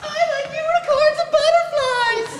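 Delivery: put-on very high, girly sing-song voice; mock little girl. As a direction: prance through it like a tiny girl.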